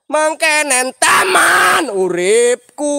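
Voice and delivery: high, loud, slow wailing cry. Acted. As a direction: wail it out like a mourner. Wailing lament in scary place. (meratap sambil menangis keras)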